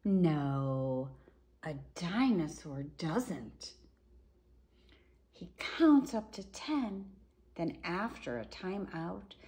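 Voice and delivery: lively, sing-song picture-book reading; reading to children. A narrator's reading. Reading a dinosaur book to children, lively storybook voice. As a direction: read it out like a bedtime story.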